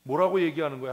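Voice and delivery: fervent preaching. Acted. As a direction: preach it to the congregation. Preacher drives home the point. (강단에서 힘주어 외치듯)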